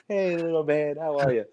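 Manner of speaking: high-pitched greeting to a dog; pet-greeting voice. Acted. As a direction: coo hello to someone's dog. Acting out greeting a stranger's dog.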